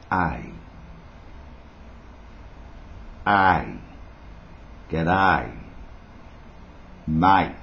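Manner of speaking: drawn-out Australian A, word by word; broad Australian vowels. Shown as a demonstration. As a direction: demonstrate the Aussie A sound slowly. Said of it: "It's the A sound".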